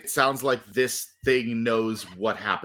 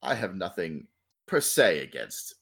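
slow theatrical villain menace; 9 semitones lower, 4 dB quieter than usual. Acted. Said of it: "It laughs, and it says".

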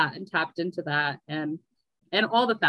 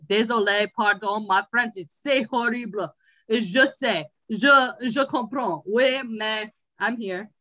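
lightly hammed broken French, slowing at the end; broken French apology; 3 semitones higher, slower than usual. Speaking to French listeners, in mock broken French. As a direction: apologise in bad French, drawn out. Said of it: "To all the French people listening".

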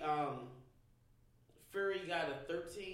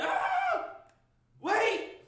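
startled yelp; 8 dB louder than usual. Retold or imitated. Startled reaction to knockdown.

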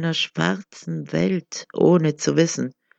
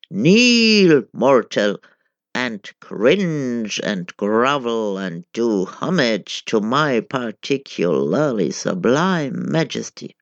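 thundering god; 6 semitones lower than usual. A narrator's reading. The angry god commands the man to kneel, thundering. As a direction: thunder down at the mortal. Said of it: "the deity thundered loudly, fat with rage".

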